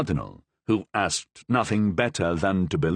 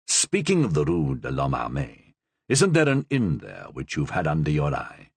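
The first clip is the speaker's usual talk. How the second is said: lightly voiced dry cardinal; 8 semitones lower than usual. The Cardinal questions.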